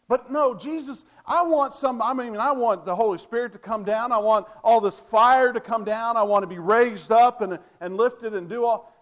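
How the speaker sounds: mock a greedy churchgoer begging Jesus for fireworks; demanding believer. Mimics people demanding spectacle.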